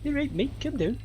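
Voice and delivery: high, soft Scouse imitation; Liverpudlian accent. Stranger repeating himself to sick student, Liverpudlian accent. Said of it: "with a Liverpudlian accent".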